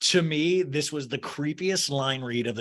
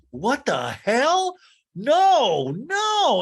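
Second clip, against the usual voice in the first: loud, much higher shocked no; horrified protest; 9 semitones higher, 6 dB louder, much slower than usual. Real, not acted. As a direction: recoil out loud, rising on the last no. Host recoils at sexting talk.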